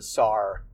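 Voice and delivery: "sar" with an R added on the end; intrusive R. Shown as a demonstration. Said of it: "putting an R on words that didn't end in R".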